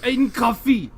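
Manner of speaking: loud cartoon Frenchman offering food; mock French accent. Cartoon Frenchman mocking the American, loud hammed-up French accent. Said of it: "talking in a French accent".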